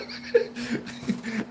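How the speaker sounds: airy silent laugh; breathy laugh. Real, not acted. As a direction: wheeze with laughter. Laugh alone.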